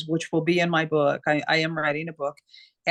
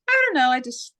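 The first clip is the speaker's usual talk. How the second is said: bright eager reply; 6 semitones higher, 3 dB louder, faster than usual. Retold or imitated. Retelling her own cheerful reply.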